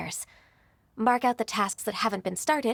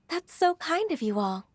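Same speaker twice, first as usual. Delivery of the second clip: laughing lightly; 5 semitones higher than usual. Acted. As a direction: speak through a small flattered laugh. Flattered by the secretaries' praise.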